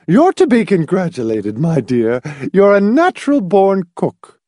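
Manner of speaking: put-on high, gushing old lady; gushing compliment. A narrator's reading. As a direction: Mrs Pritchett praises Marcia's cooking, gushing and high.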